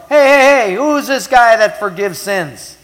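loud, high-pitched objection; acted outburst of scandalized protest; indignant challenge. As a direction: call it out like an affronted dinner guest. Acted table guests challenging Jesus.

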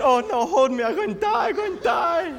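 very high, loud, hammed-up shriek of fear; mock hysterical girl. Frightened girl refusing to let go of pastor, hysterical mock panic. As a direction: wail that you're going to die. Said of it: "She said".

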